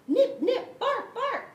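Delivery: high loud nip and bark; barking attack. Acted. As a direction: snap and bark at her. Dog attacking thief.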